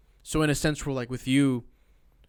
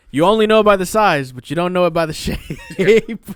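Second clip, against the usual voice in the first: loud, laughing parody; loud mock quote; 4 semitones higher, 10 dB louder than usual. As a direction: boom it out mockingly. Quoting him loudly, mocking.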